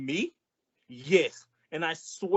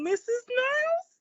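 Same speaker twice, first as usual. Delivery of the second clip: much higher, sing-song falsetto; mock-sweet greeting. Costello sweetly greeting furious Missus Niles. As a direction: coo her name innocently.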